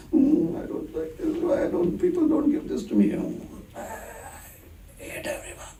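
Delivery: high, whimpering self-pity, fading into breath; mock lamenting. Someone comfortable in their misery, mock lamenting. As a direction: wallow in hammed-up self-pity. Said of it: "when you're lamenting".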